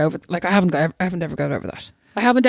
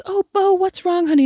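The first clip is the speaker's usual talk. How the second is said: much higher sweet teacher voice; concerned teacher; 10 semitones higher than usual. Retold or imitated. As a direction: coo at the child. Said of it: "She goes".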